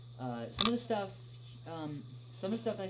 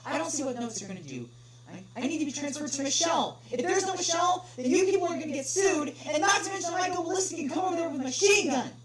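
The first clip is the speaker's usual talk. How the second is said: rising from sharp to a much higher, louder rant; angry threat; 12 semitones higher, 8 dB louder than usual. Acted. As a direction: threaten the clerk, building into rage. Caller threatens clerk.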